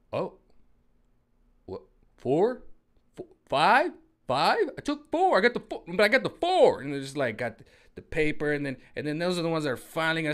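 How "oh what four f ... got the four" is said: much higher, loud startled counting; mock shock. Mocking someone realizing how many jabs, high loud surprise. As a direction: gasp in dawning alarm.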